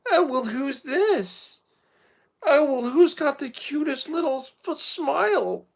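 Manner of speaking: loud, very high gushing over a dog; high-pitched, over-the-top pet talk; dog-greeting voice. Acted.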